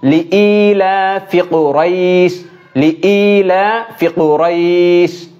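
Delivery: slow, careful লিইলাফি কুরাইশ; tajweed reading of লিইলাফি কুরাইশ; recite the verse. Shown as a demonstration. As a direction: chant লিইলাফি কুরাইশ slowly.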